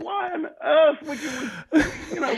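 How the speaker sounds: can't believe the mix-up; high, half-laughing disbelief; incredulous disbelief. Retold or imitated. Disbelief at wrong hotel.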